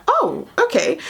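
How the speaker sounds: high startled oh; surprised. Retold or imitated. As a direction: caught off guard. Stopped in the gap.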